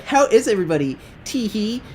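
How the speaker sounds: put-on high girly voice ending in tittering; mocked giggly girl. Girl greeting the whole team, high mock girly voice. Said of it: "she's like".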